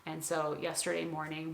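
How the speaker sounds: usual speaking voice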